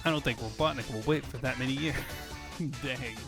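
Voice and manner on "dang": normal talking voice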